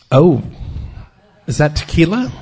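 loud startled gasp, rising at the end; shocked question. Retold or imitated. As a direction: blurt it out, scandalised. AA speaker leans over, asks.

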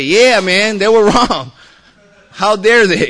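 high and loud, hamming up the indignation; hammed-up how-dare-they outrage; mock outraged ally. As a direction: play the buddy who takes your side, all fired up. Imagined friend siding with him.

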